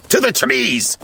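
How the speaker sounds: high-pitched rallying call; charging cry. A narrator's reading. Gutsy rallies Smurfs fleeing the cat, charging cry. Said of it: "Gutsy charged".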